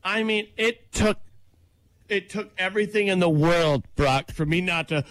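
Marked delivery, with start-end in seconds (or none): none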